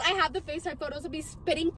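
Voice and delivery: ordinary talk, nothing marked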